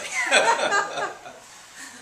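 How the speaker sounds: loud high unvoiced burst of laughter; big real laugh. Real, not acted. Lecturer laughing at his son no longer trusting him, loud real laugh. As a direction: laugh out loud at your own joke. Joke about teenage son.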